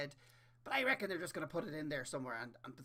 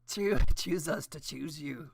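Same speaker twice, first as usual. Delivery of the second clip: slow, much louder airline announcer; air hostess voice; 11 dB louder, slower than usual. Pretend. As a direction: draw out each word like a cabin greeting. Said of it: "Air hostess voice".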